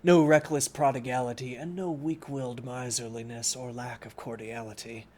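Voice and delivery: clear, steady reading-aloud voice; story narration. Acted. Streamer reading Don Quixote aloud to chat, in narration voice. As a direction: read the book to chat like a storyteller.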